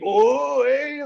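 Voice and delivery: high, loud teasing oh hey; crowd's schoolyard oooh. Retold or imitated. Crowd taunts the comedian like schoolkids, loud teasing. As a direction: tease like kids when one gets in trouble. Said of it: "like a kid got in trouble at school".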